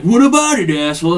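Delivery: mock taunt. Bullies confront him.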